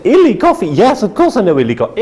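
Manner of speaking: high, excited Illy coffee; surprised recognition. Retold or imitated. Tall man recognising the brand, excited surprise. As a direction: leap up in delighted recognition.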